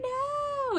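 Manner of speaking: very high squeaky no; frightened Circe. Retold or imitated. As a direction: squeak it out under the knife. Circe at knifepoint.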